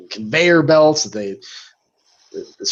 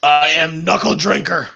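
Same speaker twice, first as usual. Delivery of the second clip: brute voice; 3 semitones higher than usual. Acted. Brute character introduces himself.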